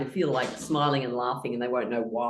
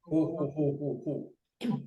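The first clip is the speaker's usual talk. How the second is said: low belly laugh; 2 semitones lower, slower than usual. Shown as a demonstration. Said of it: "hand on the belly is ho".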